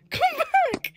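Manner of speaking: high wailing call after her; high plea. Real, not acted. Gamer calling after the fleeing girl, high plea. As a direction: beg her to come back. Girl runs away in game.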